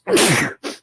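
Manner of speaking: loud sudden sneeze; a real sneeze bursting out; sneezing. Real, not acted. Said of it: "I'm gonna sneeze".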